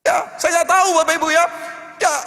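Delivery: loud, impassioned sermon cry; fiery preaching. Acted. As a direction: preach it out full force to the church. Preacher pours out full force. (khotbah berapi-api dengan suara lantang)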